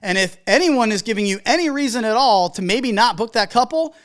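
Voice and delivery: ordinary delivery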